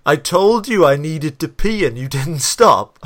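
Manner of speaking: mock angry girlfriend. Man retelling girlfriend scolding him for not stopping, mock angry. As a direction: yell it like the furious girlfriend. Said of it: "She is so angry".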